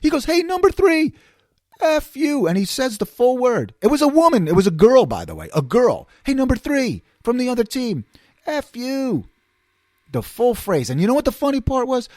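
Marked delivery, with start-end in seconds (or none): 0.2-2.5 high-pitched mock heckle; imitated heckler. Schmuck behind him heckles a player, imitated high taunt. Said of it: "there's another schmuck behind me". 6.2-9.2 high-pitched mock heckle; imitated heckler. Schmuck behind him heckles a player, imitated high taunt. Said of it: "there's another schmuck behind me".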